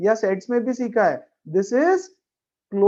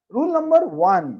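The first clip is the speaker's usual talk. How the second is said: teacher calling out the heading; loud announcement; 7 semitones higher, 6 dB louder than usual. Real, not acted. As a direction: rule number one called out high and loud. Teacher announcing the first rule.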